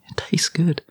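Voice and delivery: small, high, soft voice; mock-meek defence. Answering a wife who questions the spaghetti on toast, meek and high. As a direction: squeak it out quietly, playing defensive.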